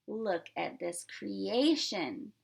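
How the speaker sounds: usual speaking voice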